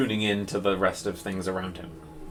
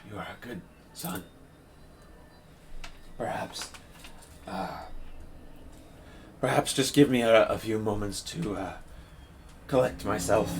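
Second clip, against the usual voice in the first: softer, cracking priest's voice through tears; a touch of a weeping priest, softer; hoarse, broken voice; 5 dB quieter than usual. Acted. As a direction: speak through tears, barely holding together. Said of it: "his voice is just, like, hoarse and, and broken".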